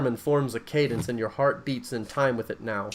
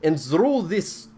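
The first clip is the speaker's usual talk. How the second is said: put-on higher, louder, slow Russian accent preaching; booming Russian accent sermon; 5 semitones higher, 7 dB louder, slower than usual. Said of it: "I'm gonna do my Russian accent".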